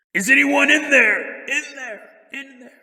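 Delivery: loud call fading to echo. Acted. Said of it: "the Bigfoot yells out of the car".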